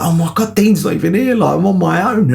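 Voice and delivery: high-pitched mock panic; retold dismay. Reliving having nobody to take photo.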